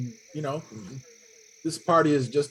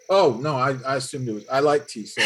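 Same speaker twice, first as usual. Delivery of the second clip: usual speaking voice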